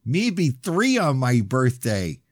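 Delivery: put-on high, slow little child voice; toddler voice. Little Lily telling Grandpa her age, high toddler voice. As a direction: speak like a proud three-year-old, slowly.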